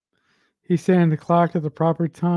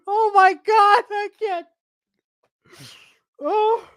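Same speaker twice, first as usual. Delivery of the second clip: mock panic; slower than usual. Panicking over sinking scene.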